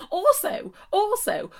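high, insistent interjection; squeaky mock-indignant protest; mock indignation. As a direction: pipe up high to object, twice.

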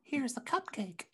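soft, slow, high offering; coaxing voice. Pretend. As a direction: offer it like bait to a pet. Mock offering to the serpent.

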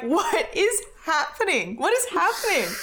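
mock confused Wookiee; bewildered. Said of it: "and it's like".

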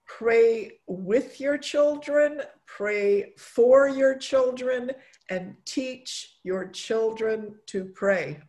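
slow, measured emphasis on each phrase; slow and deliberate. Shown as a demonstration. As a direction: spell it out slowly. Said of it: "teaching a phrase slowly".

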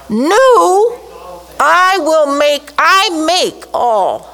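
NEW first, then "I will make, I make all" slow and loud; slow emphatic Greek order; Greek word order. Shown as a demonstration. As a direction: put "New" first and lean hard on it. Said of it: "the next word is".

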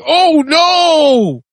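very high, drawn-out wail; hammed-up cartoon cry of horror; acted dismay. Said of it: "And he's like".

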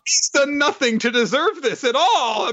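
high, hammed-up pity; mock distress. Host pities the poor boy, high mock wailing. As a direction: wail in exaggerated sympathy. Hosts mock upset for character.

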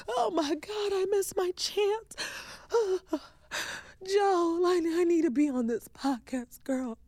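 high, breathy mock panic with a sigh; panicked fan. A listener begging the host to get on the show, high mock panic. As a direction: play a fan who fears she missed out. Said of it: "if you're like".

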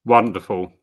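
normal talking voice

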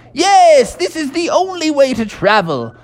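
put-on high, hearty old English toad; jolly Mister Toad. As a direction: boast like a merry old gentleman toad. Mister Toad voiced in story.

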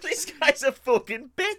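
high, drawn-out comic insult; slow, hammed-up indignant complaint; mock outrage. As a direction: play the wronged victim, pitched high. Acting out slapped April's indignation.

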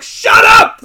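very high, loud acted scream; freaking out. Coach to kids before the prayer, freaking-out scream. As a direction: lose it and scream at them. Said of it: "like, freaking out".

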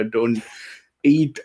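ordinary talk, nothing marked

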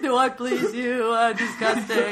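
slow, high comic whimper; half laugh, half wail in humiliation; hammed-up mortified plea, drawn out; mock tearful shame. Acting out mortified disrobing.

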